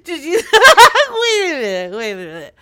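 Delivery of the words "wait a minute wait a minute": normal talking voice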